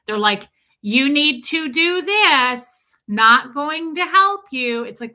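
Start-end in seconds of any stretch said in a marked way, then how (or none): 0.8-4.8 mock talking-down voice, slow, louder, higher; imitating condescending slow speech to seniors; slow patronizing. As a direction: spell it out slowly as if to someone deaf. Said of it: "they're talking to 'em slowly".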